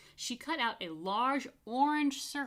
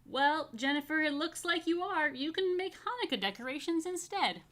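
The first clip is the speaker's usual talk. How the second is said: teacher voice; 8 semitones higher, 4 dB louder than usual. A narrator's reading. Teacher voiced higher, louder.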